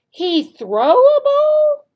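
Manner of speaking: very high, slow rising question; doubtful questioning tone. Shown as a demonstration. Teacher questioning a wrong answer, high and doubtful. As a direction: sound unsure it's right. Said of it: "showing with our tone of voice which one is the correct answer".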